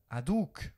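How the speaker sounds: Aduc with the accent on the final syllable, a-DUC; stress on the last syllable. Shown as a demonstration. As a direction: lean on the end of Aduc, not the start. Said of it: "on accentue sur la finale".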